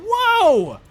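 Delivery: huge, high whoa of amazement; loud, high burst of amazement; amazed shout. Real, not acted. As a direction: shout it in awe, pitch way up. Amazed at fusion idea.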